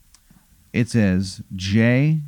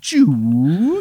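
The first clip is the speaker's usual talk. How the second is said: rising, high sci-fi whoosh; drawn-out high "choo" effect; imitated wormhole whoosh; 7 semitones higher than usual. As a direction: mimic a time warp sound. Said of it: "It goes like".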